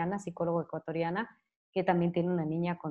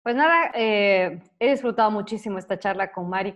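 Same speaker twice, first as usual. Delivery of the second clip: host sign-off; 2 semitones higher, 8 dB louder than usual. Real, not acted. Host closes the show.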